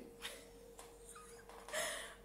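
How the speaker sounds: soft, wheezy, voiceless laughter; amused laugh. Real, not acted. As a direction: crack up quietly while telling it. Laughs telling of mom laughing.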